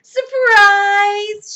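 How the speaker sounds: loud, high, long party shout; shouted greeting. A narrator's reading. Amelia Bedelia springs the surprise, shouting. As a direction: belt it out to the guest. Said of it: "shouted Amelia Bedelia".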